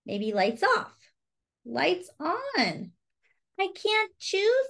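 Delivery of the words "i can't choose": whiny Charlie.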